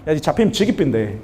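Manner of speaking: put-on light Gyeongsang accent, teasing menace; Gyeongsang dialect. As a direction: imitate a Gyeongsang man playfully threatening in dialect. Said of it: "경상도에서는".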